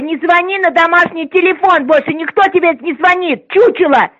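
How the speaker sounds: ordinary talk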